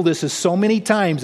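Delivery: ordinary talk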